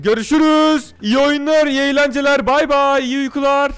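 loud, high sing-song goodbyes; mock farewell. Player taunts the dragon with cheery goodbyes.

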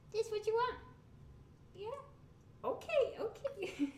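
high pet talk for a begging kitty; cooing to the cat. Acted. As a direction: coo at the cat like it's a baby.

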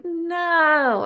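a sudden high, loud no; a high, whiny no; protesting no. Retold or imitated. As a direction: refuse like a blissed-out child. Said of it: "And I was like".

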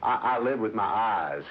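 put-on slow, drawn-out Texas accent; imitating a friend's Texas drawl. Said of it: "in his marvelous Texas accent".

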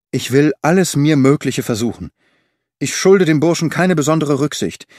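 plain reading voice

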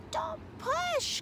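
very high little frog complaint; young frog calling. A narrator's reading. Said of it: "called a third".

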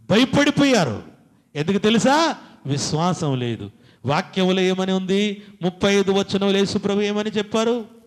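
high, loud fervent preaching cry; loud, high revival preaching; preaching at full force. Acted. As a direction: call the church to prayer with everything you have. Preacher presses the point.

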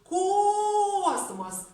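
Космос sung high and loud, first О stretched; stress on first syllable. Shown as a demonstration. Teacher sings Космос to show the stressed syllable. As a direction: sing out the КОС in Космос. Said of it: "вимовляється гучніше за інші звуки".

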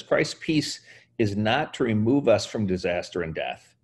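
ordinary delivery

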